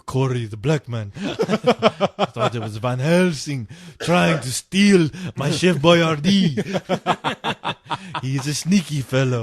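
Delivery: slow, grand vampire voice. Pretend. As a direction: purr it like a scheming count. Vampire voice continues.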